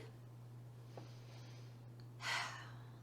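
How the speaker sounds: long, soft exhale; settling sigh. Real, not acted. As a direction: breathe out slowly and softly. Settling before the guided meditation.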